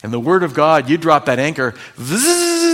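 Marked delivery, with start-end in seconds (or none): 2.0-2.7 high, buzzing zzz of a chain paying out; imitated chain whir. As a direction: mimic the anchor chain racing down, pitched up high. Anchor chain running out.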